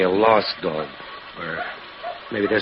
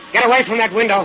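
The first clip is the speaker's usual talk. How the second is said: far higher and louder shout; 13 semitones higher, 7 dB louder than usual. Acted. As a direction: yell her away from the glass. Husband yells at intruder.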